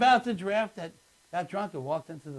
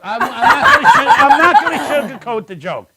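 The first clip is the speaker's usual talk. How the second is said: high, loud, breaking into laughter; real helpless laugh while protesting; 9 semitones higher, 14 dB louder than usual. Real, not acted. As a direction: push the words out through laughter. Laughing while defending his joke.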